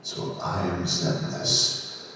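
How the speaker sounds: put-on low, slow villain with an Australian accent; stately Australian introduction, drawn out; Zemnis as an Australian. Voice actor announcing himself to fans as an Australian villain. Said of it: "Zemnis as an Australian".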